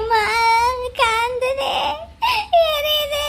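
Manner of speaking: high, drawn-out put-on moans; fake wailing in pain. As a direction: moan as if burning. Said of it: "பிள்ளை அழுகுது".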